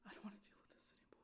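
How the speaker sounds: fast whispered brush-off; tired whisper. Retold or imitated. The clerk refusing further help, fast tired whisper. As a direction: whisper it like you've given up.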